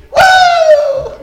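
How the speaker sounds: a sudden falsetto whoop of joy; high whoop. Real, not acted. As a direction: let out a high shout of joy. Excited whoop in sermon.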